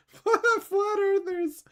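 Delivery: high, drawn-out words through laughter; laughing. Real, not acted. Laughing at flat earthers, high and drawn out. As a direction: crack up while saying it.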